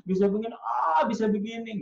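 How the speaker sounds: high, showy mock wonder; hammed-up, high-pitched amazement; overdone expressive storyteller. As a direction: imitate someone else's grand expressiveness. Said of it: "hebat ya ekspresinya".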